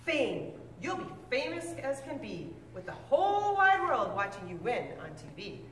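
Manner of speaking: ordinary delivery, nothing marked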